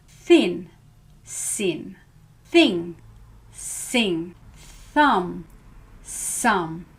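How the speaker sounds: "Thin" with th, "sin" with s, alternating slowly; th versus s pairs. Shown as a demonstration. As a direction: contrast th and s word by word. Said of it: "You try".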